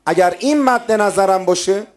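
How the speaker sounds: high, loud, fervent sermon delivery; emphatic preaching. Real, not acted. Preacher declaring devotion to God's pleasure, fervent. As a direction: lift the voice and press each phrase.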